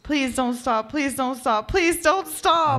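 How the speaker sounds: escalating, high-pitched desperation; building plea. Acted. Acted begging, building each time.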